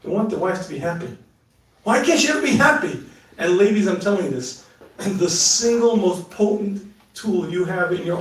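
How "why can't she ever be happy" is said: exasperated husband. Retold or imitated. Voicing frustrated husband.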